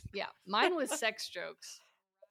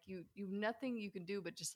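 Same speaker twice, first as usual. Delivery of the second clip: ordinary delivery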